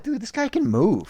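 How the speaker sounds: high-pitched fan amazement; wowed, retelling own reaction; impressed reaction. Retold or imitated. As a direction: exclaim it high, recalling being impressed. Quoting own amazed reaction watching wrestler.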